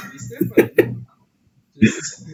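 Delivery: laughing. Real, not acted. Player laughing while pushing.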